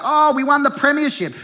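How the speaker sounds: mock gloating fan. Imitating fan, much higher.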